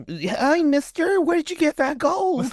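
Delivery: very high squeaky put-on voice; hammed-up falsetto curiosity; mock wide-eyed youngster. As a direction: pipe up like an awestruck kid. Voicing wide-eyed young shop clerk.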